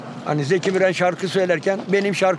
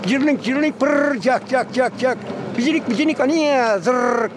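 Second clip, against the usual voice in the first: higher, slow buzzing chirps; mimicking a bird that merely chatters; amateur bird chatter imitation; 4 semitones higher, slower than usual. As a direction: drag the buzzy trills out high. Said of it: "Bunlar kuş değil, ötüyor".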